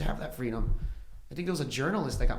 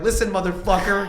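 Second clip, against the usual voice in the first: loud, laughing mock tirade; angry rant; 7 semitones higher, 10 dB louder than usual. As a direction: tell him off, half laughing. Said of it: "I was like".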